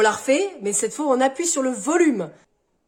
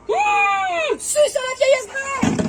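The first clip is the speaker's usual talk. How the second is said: furious yell; 12 semitones higher, much slower than usual. Acted. Shopkeeper yelling after the old thief, furious.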